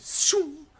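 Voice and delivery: high whooshing arrow sound; a sharp whistling shoo. A narrator's reading. Narrating arrow flying past.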